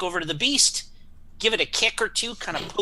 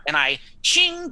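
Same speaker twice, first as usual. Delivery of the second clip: sword ching sound; 11 semitones higher, 5 dB louder than usual. Acted. Sword-drawing sound effect.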